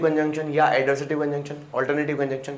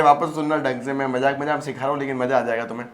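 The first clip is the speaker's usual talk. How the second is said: ordinary delivery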